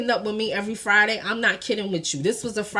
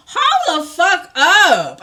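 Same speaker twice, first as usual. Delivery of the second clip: loud, high, drawn-out retold objection; outraged halt; 9 semitones higher, 7 dB louder, much slower than usual. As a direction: stop him short, stretching each word. Retold outrage at breakup reason.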